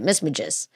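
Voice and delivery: "Magus" with a soft g, muh-JUS; two syllables in "Magus", soft g; old pronunciation. Shown as a demonstration. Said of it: "that's how I used to say it".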